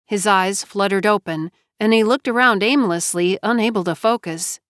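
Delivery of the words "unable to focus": usual speaking voice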